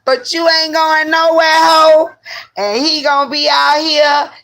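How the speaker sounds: high, loud scolding; scolding friend. Retold or imitated. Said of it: "She said".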